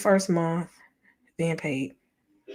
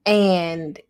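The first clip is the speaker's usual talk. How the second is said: normal talking voice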